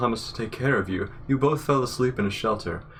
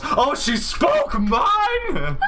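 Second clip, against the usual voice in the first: laughing shriek; much slower than usual. Real, not acted. Friend shrieking with laughter at the story. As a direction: squeal it through the laughter.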